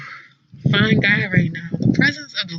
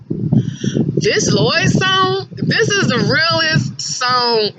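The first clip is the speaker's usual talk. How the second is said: excited; 9 semitones higher, much slower than usual. Real, not acted. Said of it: "this song is so hard".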